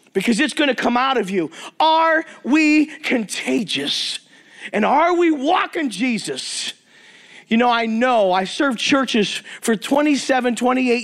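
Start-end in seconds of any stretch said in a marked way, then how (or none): 1.8-6.7 "Are we contagious?" drawn out very slowly, each word weighed; a slow, heavy challenge to the congregation; slow emphatic question. Shown as a demonstration. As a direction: let it sink in word by word. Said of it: "repeated rhetorical question".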